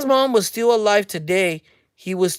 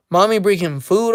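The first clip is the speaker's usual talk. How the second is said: normal talking voice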